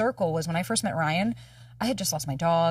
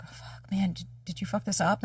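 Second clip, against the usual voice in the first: second-guess yourself in a hushed panic; a hint of retold anxious whisper; anxious self-talk; 3 dB quieter than usual. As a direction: panicky muttering to herself, dropping to a whisper. Anxious self-talk after first date.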